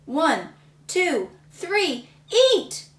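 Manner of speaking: judge yelling. Acted. Judge starting the pie contest, loud countdown. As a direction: call the start like a referee. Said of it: "He yelled".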